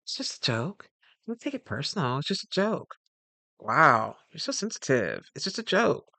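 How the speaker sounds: imitating a coworker brushing it off as a joke; a hint of a smug teaser; mocking dismissal. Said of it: "as they say".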